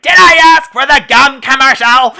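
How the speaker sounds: high, loud mock-angry yell. Film fan quoting the Triple Dent gum gag, grumpy yell. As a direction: blow up in exaggerated grumpy outrage. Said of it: "him getting grumpy at".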